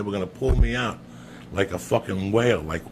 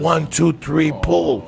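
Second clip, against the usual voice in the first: counting call; 7 semitones higher, 7 dB louder, slower than usual. Retold or imitated. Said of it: "They're like".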